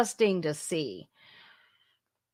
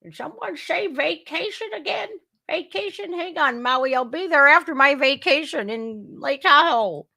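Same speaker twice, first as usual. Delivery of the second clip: put-on higher-pitched, eager old politician voice; impersonating Biden, perking up at the word vacation; mock Biden; 7 semitones higher than usual. Mocking the president ignoring Maui, eager Biden impression.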